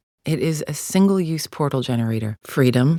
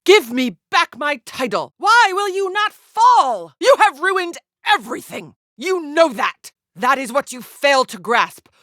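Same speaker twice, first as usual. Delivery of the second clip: high-pitched, raised voice, heated; impassioned battle fury, pitched far up; furious combat taunts; 11 semitones higher, 5 dB louder than usual. Acted. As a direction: rage at a rival mid-fight. Furious fight with a rival.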